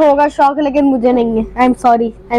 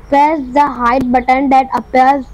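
slow reading aloud; much slower than usual. Real, not acted. Reading on-screen prompt slowly.

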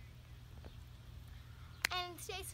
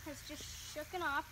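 ordinary delivery

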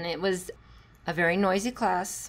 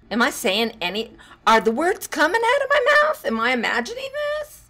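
1 mock disbelief; 8 semitones higher, 6 dB louder than usual. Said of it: "What if they completely ignore us".

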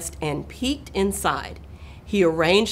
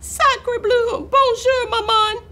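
very high squeaky French greeting; baby duck; 4 dB louder, slower than usual. A narrator's reading. Newly hatched duckling greeting the cat, excited baby voice. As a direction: peep it excitedly, voice way up. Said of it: "baby duck who looked up".

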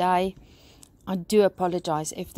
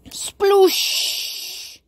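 loud, high rushing sploosh; imitated splash; 12 semitones higher, 5 dB louder than usual. A narrator's reading. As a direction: burst out like gushing water. Imitates water gushing out.

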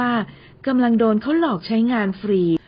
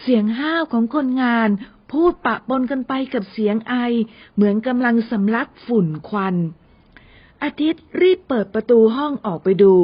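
plain reading voice

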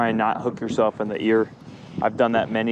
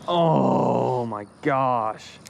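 long, drawn-out groan of disbelief; very slow, exasperated sigh of words; exasperated dismay; much slower than usual. Real, not acted. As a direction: stretch it slowly, letting it fall in frustration. Fly caught on a snag.

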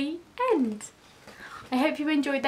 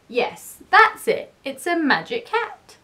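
higher, emphatic storybook insistence; pleased bluffing; 5 semitones higher, 5 dB louder than usual. A narrator's reading. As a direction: insist on the bright idea. Keith seizes on his excuse.

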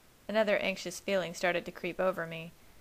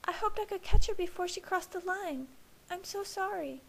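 put-on much higher, worried young woman; Alice voice; 12 semitones higher than usual. A narrator's reading. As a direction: voice the anxious sister.

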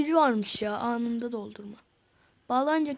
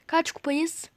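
ordinary talk

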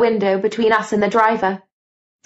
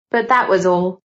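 usual speaking voice

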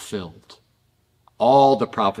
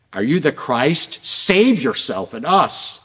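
raised, emphatic retold challenge; demanding challenge; 4 semitones higher, 3 dB louder than usual. Robber to Jesus on the next cross, demanding. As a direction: voice a robber's demand, drawing out the plea.

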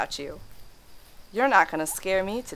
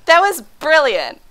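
1 delighted exclaim; 8 semitones higher, 7 dB louder, slower than usual. Real, not acted. Praising jump scare timing.

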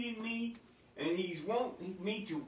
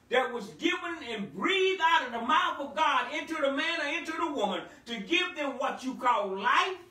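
loud, fervent preaching, higher and louder; 6 semitones higher, 5 dB louder than usual. Acted. Pastor preaches to the congregation about the soul, fervent full-force preaching. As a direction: preach it out, building the fire.

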